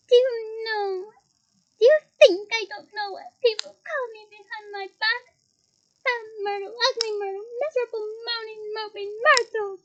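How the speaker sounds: high, loud crying wail; tearful wail. Acted. Tearful ghost Myrtle wails her nicknames at the students. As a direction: sob it out, hurt and furious. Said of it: "tears now flooding her face".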